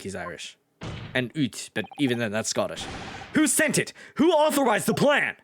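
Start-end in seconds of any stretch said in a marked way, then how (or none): 3.3-5.4 much higher, pressing questions; urgent young lawyer. Acted. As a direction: demand who gave the order. Defense lawyer demands answer.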